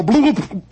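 comic pouring sound; mock bloop. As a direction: splash the bloops out like beer pouring. Beer spilling down his neck.

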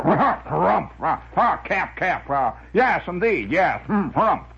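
blustering comic-strip windbag; windbag character. Retold or imitated. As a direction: harrumph and sputter between the words like a pompous windbag. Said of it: "I took to talking like this character".

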